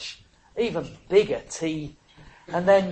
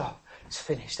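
"Oh, it's finished" breathed out low and much softer; weak last gasp; 7 semitones lower, 13 dB quieter than usual. Shown as a demonstration. Showing what Jesus did not say, a faint dying gasp. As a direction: let it die away like a last gasp. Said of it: "a sort of last gasp".

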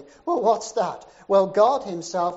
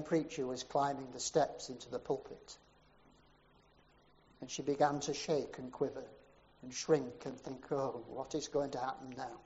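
softer, shrinking voice; fearful hush; 5 semitones lower, 10 dB quieter than usual. A narrator's reading. Narrator voicing Virtue's dread as the preacher climbs up, softly. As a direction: let the dread pull it down.

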